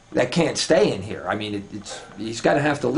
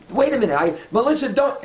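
high, flustered retold warning; urgent warning; 12 semitones higher than usual. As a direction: cut in to stop her before it's too late.

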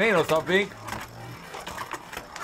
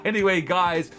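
ordinary talk

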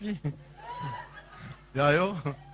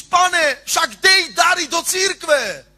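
mock pleading prayer; 7 semitones higher, 5 dB louder, slower than usual. Imitating a home prayer.

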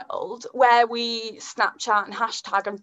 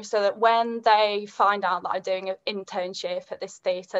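usual speaking voice

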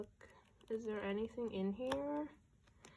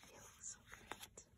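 very soft breathy whisper; almost silent swooning whisper; 21 dB quieter than usual. Real, not acted. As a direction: barely breathe it, lovestruck. Swooning over photo.

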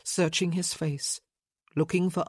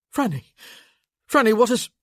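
loud, urgent cry, pitched up; alarmed calling; 5 semitones higher, 9 dB louder than usual. A narrator's reading. Man finding his friend's wife distraught, urgently alarmed. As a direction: call to her in alarm, breaking off. Rushing in after her scream.

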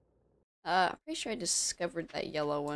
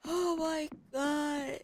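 drawn-out exasperation; 9 semitones higher, 5 dB quieter, much slower than usual. Real, not acted.

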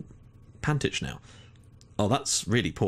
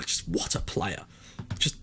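breathless whispered praise; whispered admiration for a star player; awed whisper; 3 semitones lower, 5 dB quieter, slower than usual. Real, not acted. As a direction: hush it out in awe. Admiring his top assister.

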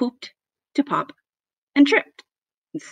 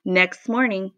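plain reading voice

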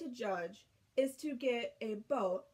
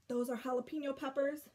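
plain reading voice

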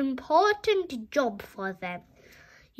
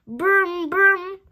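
toy car noise; 3 semitones higher, 6 dB louder than usual. Acted.

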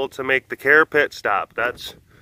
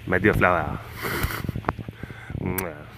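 put-on low, soft French-accented wooing; murmured mock-French sweet talk, pitched down; 6 semitones lower, 8 dB quieter than usual. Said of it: "the default accent for so many things is just French".

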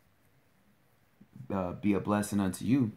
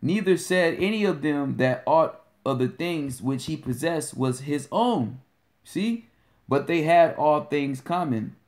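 slow, raised-pitch verse reading; scripture reading; 4 semitones higher, 4 dB louder than usual. Acted. Teacher reading Acts to the brothers, emphatic recitation. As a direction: proclaim the verse deliberately.

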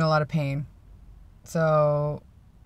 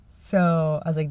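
usual speaking voice

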